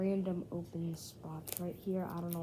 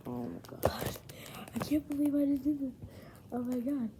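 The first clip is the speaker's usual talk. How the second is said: breathless whisper; 6 semitones higher, faster than usual. Real, not acted. Disbelief at pulled card.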